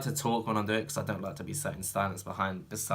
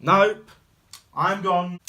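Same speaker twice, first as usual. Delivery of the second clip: loud, high refusal; mock alarm; 8 semitones higher, 8 dB louder, much slower than usual. Viewer scared off by creepy child, loud mock alarm. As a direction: blurt it out and bail.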